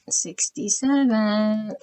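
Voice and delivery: slow drawn-out repeat. Real, not acted. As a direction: say it slowly, aloud this time. Repeats number slowly aloud.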